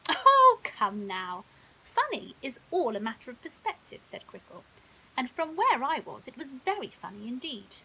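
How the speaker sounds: lightly voiced crocodile, amused and loud. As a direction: tease him, enjoying the joke. Narrator voices Crickle, amused.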